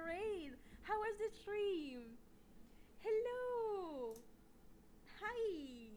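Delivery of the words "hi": usual speaking voice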